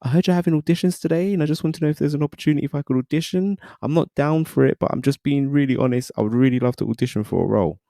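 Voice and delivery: high-pitched, polite and eager request; imitated earnest pleading. Young actor asks for an audition over intercom, high earnest pleading.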